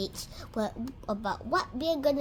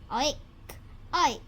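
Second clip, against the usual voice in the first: pig oinks. Acted. Imitating a pig.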